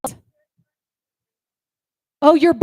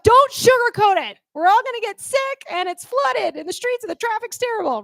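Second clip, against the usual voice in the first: exasperated retort; 6 semitones higher than usual. Retold or imitated. Friend caught in rain snaps back, exasperated. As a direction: snap back at the sugarcoating. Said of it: "And you're like".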